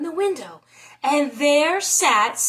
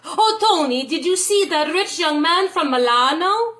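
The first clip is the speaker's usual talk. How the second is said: higher, louder breathless gossip; an aunt bursting with news; hammed-up eager aunt; excited gossiping aunt; 5 semitones higher, 5 dB louder than usual. Aunt teasing Tony.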